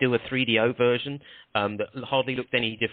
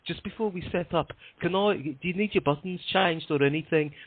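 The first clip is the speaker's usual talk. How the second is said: put-on higher-pitched Brummy accent; 6 semitones higher than usual. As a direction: imitate a producer asking in Brummy. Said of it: "so I'll do a Brummy accent".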